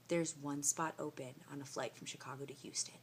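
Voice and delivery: soft, low retold reply; acted-out near whisper; mock hushed clerk. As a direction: share it as hushed news.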